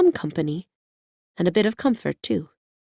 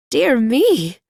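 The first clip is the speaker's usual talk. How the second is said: higher, louder surprised cry; surprised exclamation; 7 semitones higher, 7 dB louder than usual. A narrator's reading. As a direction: caught off guard by a talking hen. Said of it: "she exclaimed in surprise".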